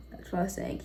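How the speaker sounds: soft whisper to herself; quick whispered self-correction; whispered aside. Real, not acted. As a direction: drop to a whisper, half to yourself.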